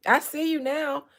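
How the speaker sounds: ordinary talk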